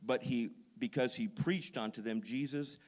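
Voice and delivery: loud, ringing pulpit delivery; full-force preaching. Acted. Pastor fires up the congregation on Paul, preaching loud. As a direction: preach it out to the whole room.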